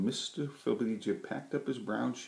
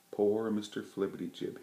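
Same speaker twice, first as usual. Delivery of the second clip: ordinary delivery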